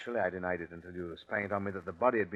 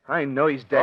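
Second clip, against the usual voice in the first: much louder, forceful insistence; insistent shout; 2 semitones higher, 12 dB louder than usual. Acted. Suspect insists to the detective that Hendricks is dead, shouting. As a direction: shout it as if denying the impossible.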